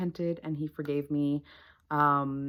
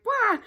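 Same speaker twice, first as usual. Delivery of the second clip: high fake baby cry; a whiny mock sob; mock wail; 5 dB louder than usual. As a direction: drama queen wah. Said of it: "being a little drama queen".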